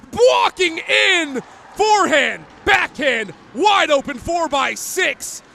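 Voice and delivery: shouted goal call; rapid hockey play-by-play. Real, not acted.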